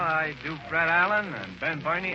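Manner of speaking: ordinary talk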